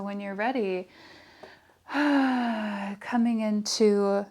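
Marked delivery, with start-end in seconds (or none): 1.9-3.0 audible, voiced sigh. Real, not acted. Yoga teacher settling into a resting position, audible sigh. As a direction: let out a big exhale.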